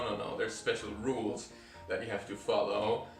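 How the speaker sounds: normal talking voice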